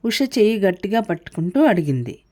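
plain reading voice

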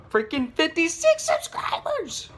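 high, loud, stretched-out cheer; real thrilled shout; excited yell. Real, not acted. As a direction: shout the number out in delight. Excited shout about subscriber count.

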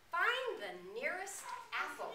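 mild storybook command; king's order. A narrator's reading. King gives the general an order, mildly commanding. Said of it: "said the king".

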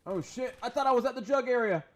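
high, loud startled outburst; panicked yelp. Real, not acted. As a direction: blurt it in sudden panic. Panics in the wrong spot.

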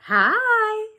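very high, loud drawn-out hi; high-pitched little critter greeting; chirpy lizard. A narrator's reading. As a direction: squeal a big hello. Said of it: "said a lizard".

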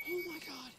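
startled squeak. Real, not acted. Right after the scare.

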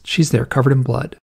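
ordinary delivery

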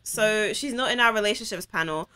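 ordinary talk

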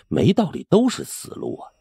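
usual speaking voice

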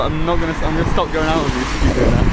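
ordinary talk, nothing marked

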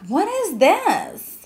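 high, slow, baffled question; puzzled surprise. Retold or imitated. Reacting to an unfamiliar pageant with high puzzled surprise. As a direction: draw it out in disbelief.

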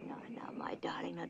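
hushed reassurance to scared kittens; calm them in a whisper; soothing whisper. Acted. Mother soothing frightened kittens.

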